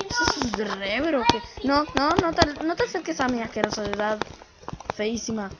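ordinary delivery

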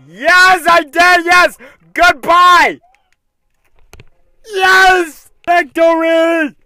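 high loud victory cheers; triumphant cheering. Real, not acted. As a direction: celebrate at the top of voice. Celebrating clearing the level.

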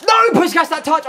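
high, loud groan of a cry; pained high yell; dismayed shout. Real, not acted. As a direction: react as the shot slips away. Chance goes wrong.

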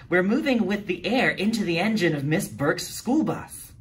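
high, eager explaining voice; girl reading from her research book; know-it-all girl. A narrator's reading. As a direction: read it out like the class expert.

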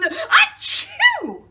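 high explosive fake sneeze; a loud, high, hammed-up sneeze. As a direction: sneeze it out big. Gerald sneezes again.

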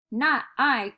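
growling dog. A narrator's reading.

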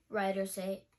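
ordinary delivery, nothing marked

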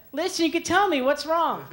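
put-on high, chirpy girl voice; girl texting. Girlfriend texting her boyfriend from lunch, high and chirpy. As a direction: play the bubbly girlfriend opening a chat. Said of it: "the girl".

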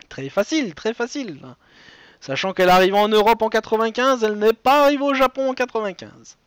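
usual speaking voice

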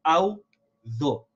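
"أعوذ" split in two, "ow... dhu"; two-syllable slip. Shown as a demonstration. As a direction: break "أعوذ" into just two beats. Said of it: "we say it as two".

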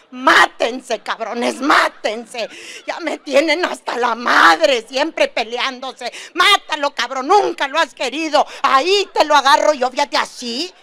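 loud, half-laughing mock fury at the kids; a fed-up mother hamming up a rant at her kids; exasperated mom yelling. Mamá exasperada grita a niños. (mamá harta gritando en broma a sus hijos)

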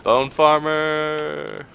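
searching singsong holler; calling out. Real, not acted. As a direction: stretch the name out to find someone. Calling out for a missing teammate.

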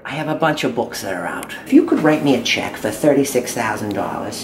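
put-on older mom voice. Holds mother character voice.

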